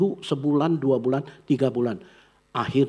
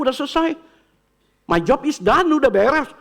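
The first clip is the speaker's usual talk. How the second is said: put-on high, louder eager kid; higher, louder excited child; 13 semitones higher, 6 dB louder than usual. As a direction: play the child who wants to go out.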